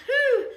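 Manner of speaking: loud, high falling whew; exertion whew. Real, not acted. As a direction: let out a big whew from the strain. Holding plank after tiring reps.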